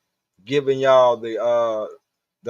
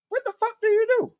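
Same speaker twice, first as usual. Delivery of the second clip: mock disbelief; faster than usual. Mock reaction to chat.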